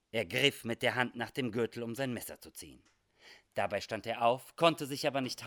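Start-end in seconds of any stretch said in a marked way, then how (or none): none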